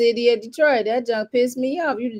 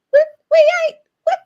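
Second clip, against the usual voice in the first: exasperated disbelief; 8 dB louder than usual. Retold or imitated. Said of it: "You like".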